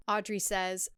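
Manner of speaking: ordinary delivery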